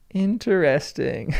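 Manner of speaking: "Interesting" stretched slowly over every syllable; drawn-out repeat. Pretend. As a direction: draw out "Interesting" as in-ter-est-ing. Stretched, mock-pondering repeat.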